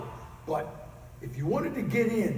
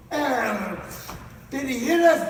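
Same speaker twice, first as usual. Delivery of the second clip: loud, startled outburst; acted loud alarm on waking; 6 semitones higher, 7 dB louder than usual. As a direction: jolt awake and blurt it out. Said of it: "my uncle wakes up and goes".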